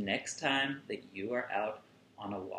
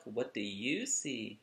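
usual speaking voice

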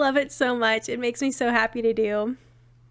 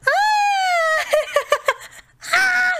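frightened scream. Real, not acted. Startled by horror game.

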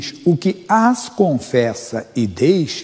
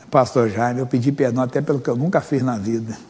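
ordinary talk, nothing marked

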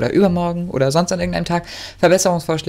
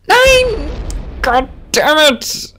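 high, loud cry of frustration; anguished scream; 13 semitones higher, 4 dB louder, much slower than usual. Real, not acted. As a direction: scream it at the failure. Scheitert knapp. (verzweifelter hoher Schrei)

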